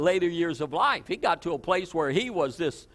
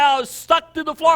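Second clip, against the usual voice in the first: amazed retelling; 8 semitones higher, 7 dB louder than usual. Retold or imitated.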